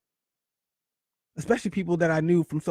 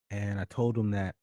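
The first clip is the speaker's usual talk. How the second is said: ordinary talk, nothing marked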